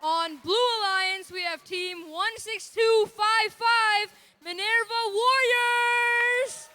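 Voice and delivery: loud, slow ring-announcer build; hype announcer. Acted. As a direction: stretch each team name to pump the crowd. Hyped team introductions.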